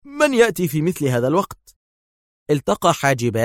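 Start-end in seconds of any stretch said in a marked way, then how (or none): 0.0-1.8 high startled call; exclaiming. A narrator's reading. Yael startled by knocking, exclaiming alarmed. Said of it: "فهب يائيل من مقعده هاتفا".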